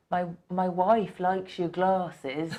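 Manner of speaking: put-on slow, laughing Dorset accent; a lightly voiced Dorset local, very slow; half-laughing Dorset accent. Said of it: "imagine that in a Dorset accent".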